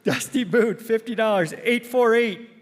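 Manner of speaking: loud, high prize announcer; raffle caller. Acted. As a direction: call the numbers slowly to the crowd. Calling raffle prizes and numbers.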